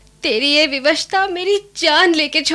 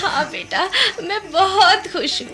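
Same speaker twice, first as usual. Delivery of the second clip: laughing through tears. Acted. Grandmother laughing through tears at grandson's consent. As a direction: laugh and cry with joy at once.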